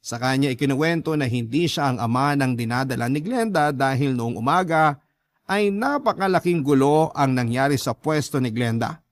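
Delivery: ordinary talk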